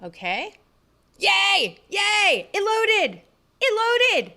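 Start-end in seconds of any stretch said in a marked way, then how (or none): 1.2-4.3 high, loud cheering; rowdy party animal. Real, not acted. World finally loaded.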